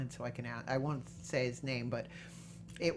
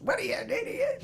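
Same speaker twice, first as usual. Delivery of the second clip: high squealing mock scolding; squeaky comic yell, played for laughs; Jerry Lewis. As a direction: do a manic comic's high-pitched berating. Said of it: "like, Jerry Lewis".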